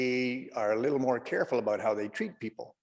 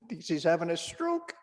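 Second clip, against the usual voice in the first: normal talking voice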